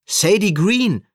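loud, high, drawn-out call. A narrator's reading. Sam tries to reach Sadie over station noise, calling out. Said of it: "rief Sam abermals".

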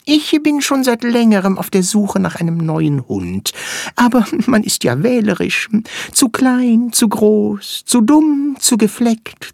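high, quick little flea voice; squeaky tiny creature. Acted. Flea character speaks.

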